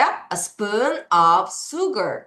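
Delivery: very slow "A spoon of sugar"; slow teacher demo; slow model reading. Shown as a demonstration. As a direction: read it slowly word by word. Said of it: "어떻게 한다고요".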